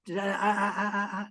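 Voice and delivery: stuttering "ah-ah-ah" signal to stop; rapid repeated wordless syllables; imitated "enough" noise. Comedian's foreigner asking how to spell a pouring signal, stuttered wordless noise. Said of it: "How do you spell?".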